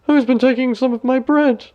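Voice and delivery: high squeaky little dwarf voice; high little dwarf asking who took the bread; third dwarf. A narrator's reading. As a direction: pitch way up, a tiny fussy dwarf. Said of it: "The third".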